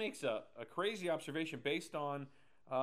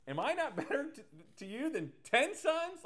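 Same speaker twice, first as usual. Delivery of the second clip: much higher, incredulous laughing echo; mocking disbelief; 10 semitones higher, 5 dB louder than usual. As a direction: repeat it like it's absurd, laughing. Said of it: "Like, what is that?".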